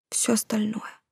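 unvoiced whisper, trailing off. A narrator's reading. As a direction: drop to a bare whisper of embarrassment. Смущённое признание шёпотом. (смущённый шёпот)

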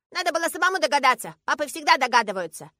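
put-on high, loud childish voice; scolding little girl. A narrator's reading. Girl scolding father for not guessing, indignant. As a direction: lecture him like a know-it-all child.

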